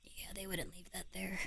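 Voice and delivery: barely voiced whisper; whispering to herself while checking the room. Real, not acted. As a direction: keep it under your breath. Whispered reasoning.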